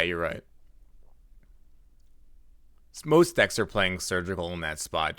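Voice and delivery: ordinary delivery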